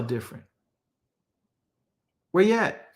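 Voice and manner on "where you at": a higher, slangy call; casual street-talk God. Acted. God looks for hiding Adam, casual slangy call. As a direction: toss it off like a friend looking for you. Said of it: "why would he be saying".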